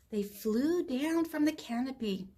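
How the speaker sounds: ordinary delivery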